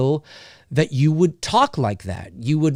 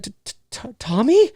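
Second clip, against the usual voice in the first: mock stammer; 6 semitones higher than usual. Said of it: "And she's like".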